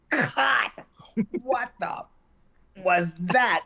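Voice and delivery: high-pitched mock outburst; retold director's yell. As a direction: jump up high on an exasperated director's cut. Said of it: "Jerry London yells".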